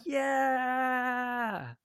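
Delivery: high cheer held out long; wild fan cheer. Acted. Game master plays Crab cheering wildly, long high yell. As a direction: stretch the cheer out like a crazed fan.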